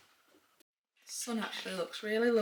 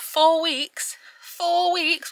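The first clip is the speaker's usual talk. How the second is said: 1 exasperated; 9 semitones higher, 9 dB louder, much slower than usual. Real, not acted. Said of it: "exasperated at road works".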